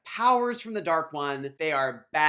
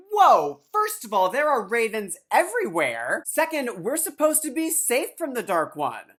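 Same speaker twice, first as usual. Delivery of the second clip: shocked outburst; 8 semitones higher, 7 dB louder than usual. Retold or imitated. Rand shocked about the ravens.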